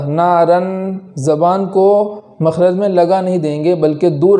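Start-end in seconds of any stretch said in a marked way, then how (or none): none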